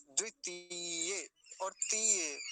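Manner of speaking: द्वितीय slowly, stretching ती; short द्वि, long held ती in द्वितीय. Shown as a demonstration. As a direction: draw out the ती in द्वितीय and तीय. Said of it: "तो लंबा खींचेंगे थोड़ा सा".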